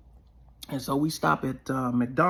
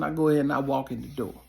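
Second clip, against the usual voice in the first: ordinary talk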